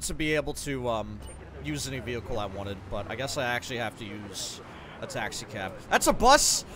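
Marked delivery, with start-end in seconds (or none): 5.9-6.6 high, loud startled exclaim; surprised outburst. Real, not acted. Gamer spotting a bus on screen, surprised outburst. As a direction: blurt it out in shock.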